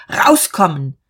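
contained, sharp command; shouted order. A narrator's reading. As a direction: order them out with a restrained shout. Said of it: "schrie er".

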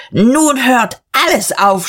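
loud, high and drawn-out; indignant narrated yell, pitched well up; outraged shout. A narrator's reading. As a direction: cry out in outrage, stretching the words. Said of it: "schrie er".

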